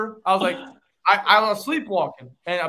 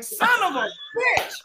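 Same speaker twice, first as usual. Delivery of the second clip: shocked curse; 13 semitones higher than usual. Retold or imitated. Realizing the trash can truth.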